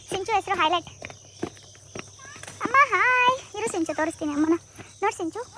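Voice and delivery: ordinary talk, nothing marked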